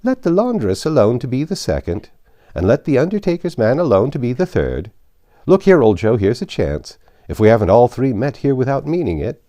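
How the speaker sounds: high, loud coarse charwoman voice; cockney charwoman crying out. Acted. Charwoman crowing to old Joe, high and loud. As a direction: cry it out, brash and pleased with herself.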